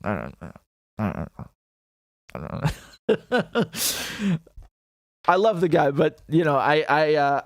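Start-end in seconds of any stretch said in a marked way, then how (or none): 0.0-4.4 a low, mumbling mock Gaddafi, trailing off slowly; light Gaddafi impression, low and dragged out; imitating Gaddafi. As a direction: mumble it like a rambling old dictator, half in jest. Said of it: "sometimes Gaddafi's like this".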